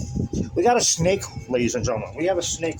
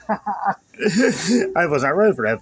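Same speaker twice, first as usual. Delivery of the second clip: laughing; 3 semitones higher, 3 dB louder, much slower than usual. Real, not acted. Laughing at own recipe mistake.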